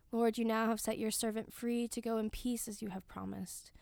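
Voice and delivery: ordinary delivery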